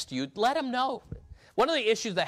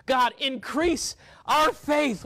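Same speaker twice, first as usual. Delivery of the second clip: much higher, louder, slow pleading prayer; desperate prayer; 11 semitones higher, 5 dB louder, much slower than usual. Retold or imitated. Apostles begging the Lord for more faith, slow and desperate. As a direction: stretch out each word as if begging for help. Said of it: "like, this desperate".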